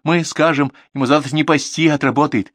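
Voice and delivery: high, insistent promising; quick assurance. A narrator's reading. Valentina promises father will work tomorrow, quick assurance. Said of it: "быстро сказала Валентина".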